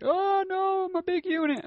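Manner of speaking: very high squeaky mock dismay; mock high panic. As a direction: squeal it in fake alarm. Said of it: "it was like".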